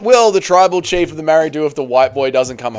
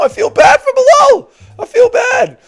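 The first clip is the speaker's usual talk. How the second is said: high, loud mock cry of guilt; mock shout; 6 dB louder than usual. Streamer hamming up guilt over a viewer's donation with a shout. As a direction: yell it out in hammed-up sympathy.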